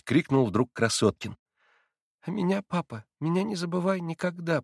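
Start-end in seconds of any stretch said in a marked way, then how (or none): 2.2-4.6 higher, softer, pleading; tender dying child. A narrator's reading. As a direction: ask his father to remember him. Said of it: "продолжал Илюша". (тихо, печально)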